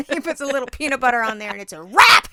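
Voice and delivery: loud gleeful build to a shout; cackling with glee; excited. Real, not acted. Gleeful rant about snap traps.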